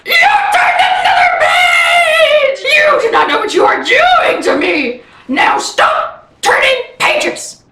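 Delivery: very high, stretched cartoon yell; explode, then slow for the final order; Grover, angry shouting. Grover scolds reader for turning another page, furious shouting. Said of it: "he's so angry, he's shouting stars!".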